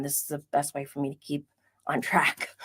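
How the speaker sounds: soft confiding whisper; whispered aside. Real, not acted. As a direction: let them in on a little secret. Aside to viewers.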